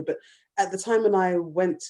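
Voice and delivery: ordinary talk, nothing marked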